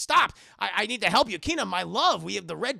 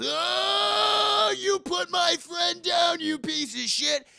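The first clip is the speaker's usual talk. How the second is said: long high scream into slow, shouted threat; acted furious shout, high and drawn out; enraged battle cry; 9 semitones higher, 6 dB louder, much slower than usual. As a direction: roar in like an anime hero avenging a friend. Enraged friend bursts into the scene.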